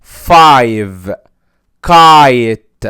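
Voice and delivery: فايف and كايت drawn out slowly with a full ai; long i examples. Shown as a demonstration. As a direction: stretch each word slowly, teacher model. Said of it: "نبدا ندي امثله".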